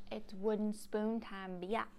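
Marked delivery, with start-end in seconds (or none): none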